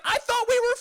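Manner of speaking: high, loud hurt whine; mock wounded plea. To Barb's grandson in the car, a high hurt whine. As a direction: whine it up high like a betrayed friend. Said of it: "I was like".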